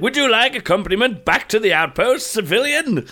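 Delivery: hammed-up politeness; mock patrol officer. Offering an escort to players in a mock official voice.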